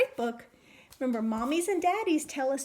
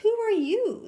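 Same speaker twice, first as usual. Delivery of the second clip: rabbit asking; 8 semitones higher, 6 dB louder than usual. Acted. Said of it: "the rabbit asked".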